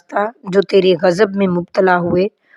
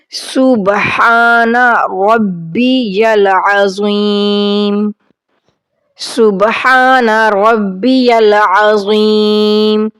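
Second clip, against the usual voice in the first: slow, drawn-out Arabic recitation; much slower than usual. Shown as a demonstration. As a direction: recite each word slowly and clearly. Teacher recites prayer slowly.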